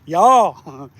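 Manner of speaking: stretched Southern y'all; mock Southern accent, drawn out; southern drawl y'all. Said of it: "Get that southern accent going on there".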